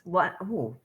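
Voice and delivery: usual speaking voice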